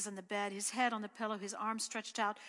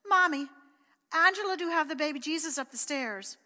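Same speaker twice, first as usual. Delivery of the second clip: calling out; 5 semitones higher, 6 dB louder than usual. A narrator's reading. Pat calls down to his mother.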